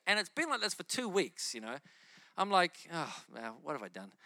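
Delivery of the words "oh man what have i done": low, soft dismayed aside; muttered worry. Retold or imitated. Preacher remembering his worried thought, low and soft. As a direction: groan it quietly to yourself. Inner panic, recalled.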